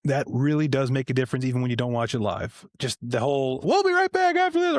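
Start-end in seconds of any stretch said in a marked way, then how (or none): 3.6-4.8 very high, put-on commercial-break voice; mock TV announcer. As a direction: parody a cheery break announcement in a squeaky pitch.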